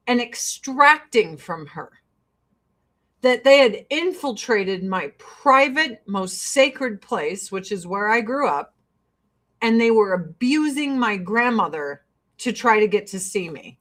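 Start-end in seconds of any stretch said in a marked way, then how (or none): none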